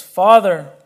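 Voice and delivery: Father called out loud and strong; loud cry. Acted. Interpreter voicing Jesus crying to the Father, loud call. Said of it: "called out with a loud voice".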